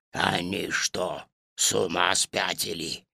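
low hushed bear whisper. A narrator's reading. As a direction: ask it under your breath, low. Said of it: "шёпотом спросил медведь". (медведь шёпотом)

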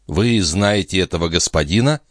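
plain reading voice

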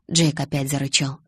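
usual speaking voice

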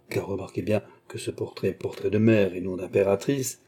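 plain reading voice

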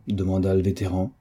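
usual speaking voice

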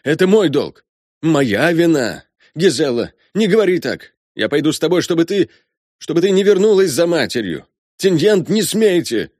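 high, loud, insistent father; distraught colonel. Acted.

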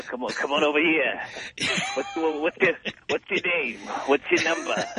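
lightly voiced New York tough guy; tough New York dude. Caller mocking New York women's accent, lightly voiced tough dude. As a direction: imitate a New York woman who sounds like a dude. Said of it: "like a tough dude".

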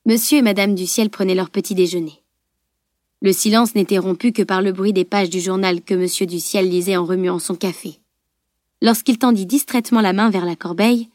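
usual speaking voice

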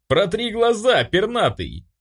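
high-pitched outraged shout; yelling. A narrator's reading. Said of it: "заорал тот".

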